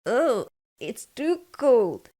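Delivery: put-on a light, slow childish whine; girl's complaint. A narrator's reading. Goldilocks complaining about hot porridge, lightly voiced. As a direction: complain like a little girl.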